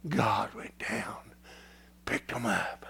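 hushed, slow whisper of the story. Acted. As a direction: drop to a breath as if sharing a secret. Preacher hushes to tell the cross.

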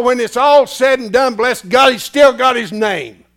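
loud, high preaching; preaching at full force. Acted. Preacher declaring his name to the church, loud. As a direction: thunder it out.

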